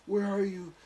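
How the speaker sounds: put-on high girly impatient question; girlfriend voice. Girlfriend calling on phone.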